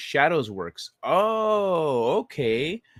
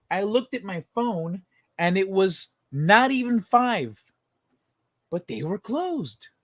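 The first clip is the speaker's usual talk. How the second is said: annoyed; 5 semitones higher, slower than usual. Real, not acted. Annoyed rant about closed manufacturer.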